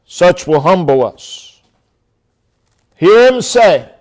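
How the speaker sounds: ordinary talk, nothing marked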